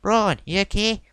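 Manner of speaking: high-pitched imitation of a Southern American accent; a Southern drawl, pitched well up, calling out to a hurt friend. Backstage official checking on the fallen Braun, in a Southern accent. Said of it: "In his southern accent".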